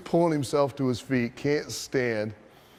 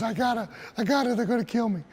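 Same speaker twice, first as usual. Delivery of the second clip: high, fast mock panic; rushed, high-voiced retold pleading; frantic protest; 9 semitones higher, faster than usual. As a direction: imitate a panicked man making excuses.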